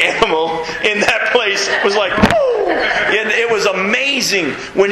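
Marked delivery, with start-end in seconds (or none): 2.1-2.7 startled whoa. Retold or imitated. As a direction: jump with alarm on whoa. Animals reacting to lion roar.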